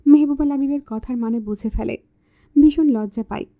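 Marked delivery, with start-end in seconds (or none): none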